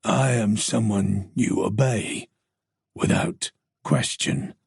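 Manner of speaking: low, slow, fading to a hushed threat; menacing stranger. Acted. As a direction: command obedience coldly. Stalker voiced by narrator.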